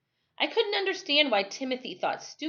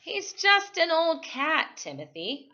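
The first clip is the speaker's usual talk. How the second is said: protesting; 8 semitones higher, slower than usual. Acted. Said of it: "I protested".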